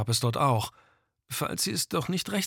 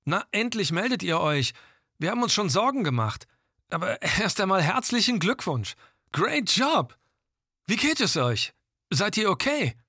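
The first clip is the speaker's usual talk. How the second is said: higher voice through the radio; a base camp voice crackling over the radio; over walkie talkie; 7 semitones higher than usual. Acted. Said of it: "eine Stimme drang aus dem Funkgerät".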